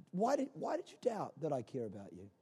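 very low, hushed question; almost murmur it, gently; soft, intimate voice of Jesus; tender reproach. Acted. Jesus answering the frightened disciples.

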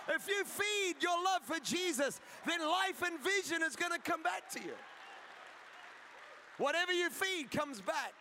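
rising, forceful preacher's refrain; impassioned preaching. Real, not acted. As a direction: drive each promise home to the congregation.

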